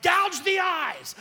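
high, loud, slow panicked yell; frantic shout. Retold or imitated. Retelling yelling at his family while swimming, slow frantic shouts. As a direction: bellow it at the kids in the surf.

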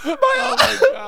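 high, helpless laughing cry; losing it completely with laughter; hysterical laugh. Real, not acted.

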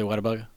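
"Whataburger" softly said as "wadda-bugga"; dropped-r pronunciation. Real, not acted. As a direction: drop the r's in "Whataburger", ending on "-bugga". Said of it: "Why did you say it like that?".

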